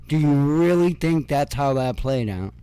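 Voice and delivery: ordinary delivery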